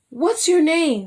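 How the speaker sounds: a touch of amazed excitement; surprised question. A narrator's reading. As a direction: ask as if you've found him at last. She sees the dream man.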